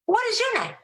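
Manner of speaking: high, chirpy voice of a friendly stranger; Rachel. Retold or imitated. Seatmate greeting the speaker on the plane, high chirpy voice. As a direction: pitch way up, bubbly and eager. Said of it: "she said".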